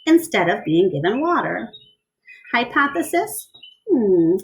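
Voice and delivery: lively storybook read-aloud; bright picture-book reading; reading to children. A narrator's reading. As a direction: read it to a class of kids.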